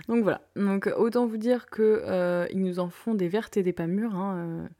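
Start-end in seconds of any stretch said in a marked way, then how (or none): none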